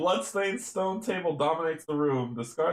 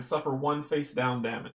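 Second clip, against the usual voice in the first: ordinary talk, nothing marked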